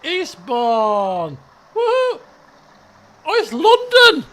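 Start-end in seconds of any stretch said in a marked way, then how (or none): 1.7-4.2 high, loud whoop and exclamation; excited whoop. Real, not acted. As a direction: cheer like you've found home. Cheers at recognizing London.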